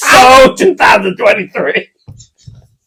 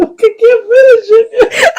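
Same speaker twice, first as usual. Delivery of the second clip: high, slow giggly voice; can barely finish for laughing; 14 semitones higher, slower than usual. Real, not acted. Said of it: "I can't read this article".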